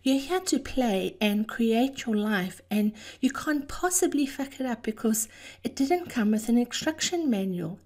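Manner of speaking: ordinary talk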